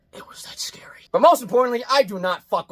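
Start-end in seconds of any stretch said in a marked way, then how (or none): 0.1-0.9 hushed whisper, very soft; whispered admission. Real, not acted. Reviewer admitting the old movie scared him, whispered. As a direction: confess it in a whisper.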